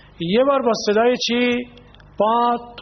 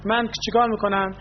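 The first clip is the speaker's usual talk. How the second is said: ordinary talk, nothing marked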